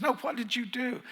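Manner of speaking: the surgeon pressing, high and rising; insistent surgeon. Retold or imitated. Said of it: "He says".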